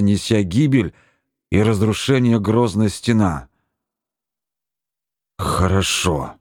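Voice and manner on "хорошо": low, reluctant agreement; said with effort. A narrator's reading. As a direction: force the word out. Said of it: "с усилием сказал".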